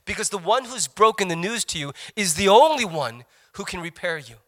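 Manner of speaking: usual speaking voice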